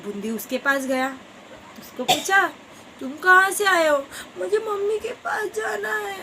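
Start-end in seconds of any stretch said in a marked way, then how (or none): 3.0-6.1 high tearful wail; crying child. Acted. Lost little dew drop wailing for mummy, tearful. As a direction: sob out wanting mummy.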